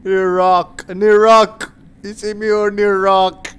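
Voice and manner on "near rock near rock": ordinary talk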